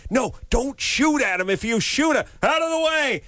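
acted high-pitched frantic warning; mock movie-hero shout to stop; urgent warning. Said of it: "the person is saying".

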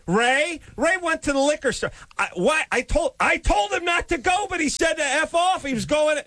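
high-pitched, protesting coworker. Acted.

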